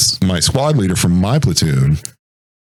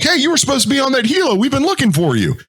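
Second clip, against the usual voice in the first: high, fast, rushed scolding; an officer hustling him along; hurried officer; faster than usual. Retold or imitated. Officer scolding wounded man.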